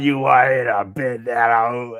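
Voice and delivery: slow, mocking Sith Lord rasp; lightly voiced dark lord sneer; mock Umar. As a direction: drag it out like a movie villain. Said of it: "Sound like a Sith Lord".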